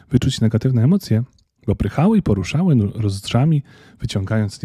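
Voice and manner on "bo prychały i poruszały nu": plain reading voice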